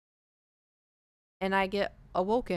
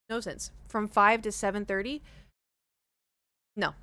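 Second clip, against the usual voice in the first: usual speaking voice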